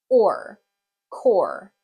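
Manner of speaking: "or" held, then blended into "core"; sounding out. Shown as a demonstration. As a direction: stretch or, add the k for core. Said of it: "going to sound like this".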